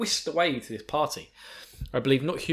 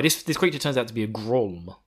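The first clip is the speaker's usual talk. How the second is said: usual speaking voice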